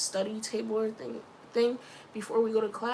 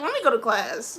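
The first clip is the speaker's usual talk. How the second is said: loud, insistent acted exit line; exasperated, punched-up retelling; emphatic retreat; 7 dB louder than usual. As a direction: announce it loudly, wanting out. Retold escape, repeated emphatically.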